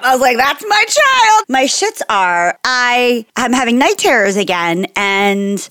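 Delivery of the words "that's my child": proud squeal. Retold or imitated. Proud mom reaction.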